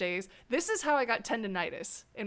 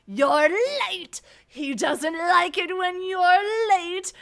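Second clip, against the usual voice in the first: loud, high, slow and menacing; scare actor warning; 9 semitones higher, 10 dB louder, slower than usual. Retold or imitated. Scare actor shouting at guests who turn the corner, loud and slow. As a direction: bellow it at the guests like a haunted-house servant. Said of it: "I would say something like".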